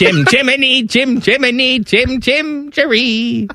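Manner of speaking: high put-on voice with laughs; high laughing cartoon voice; silly voice. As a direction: goof around in a high cartoon voice.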